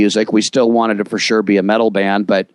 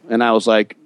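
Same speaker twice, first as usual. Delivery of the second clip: usual speaking voice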